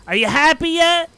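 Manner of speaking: loud, high gnome voice; high, loud, theatrical asking; Jester's pointed question. Acted. Game master voices Jester asking Momo.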